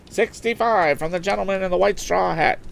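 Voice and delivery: high, brisk auction call selling the boat; auctioneer's patter. Acted. Auctioneer selling a motorboat to the lakeside crowd, high patter. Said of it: "called Mister Wood".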